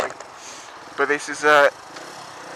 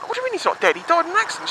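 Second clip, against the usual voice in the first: shocked disbelief; 12 semitones higher, much faster than usual. Retold or imitated. Mum says friend not dead.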